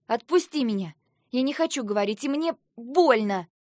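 high, loud protest; distressed shout. A narrator's reading. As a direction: cry out to be released. Heroine shouts, struggling in painful grip.

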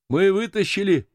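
high, moved announcement; trembling voice. A narrator's reading. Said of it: "дрожащим голосом". (взволнованно, высоким голосом)

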